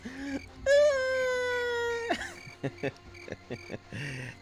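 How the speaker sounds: high shrieking burst of laughter. Real, not acted. Laughs at own fat joke.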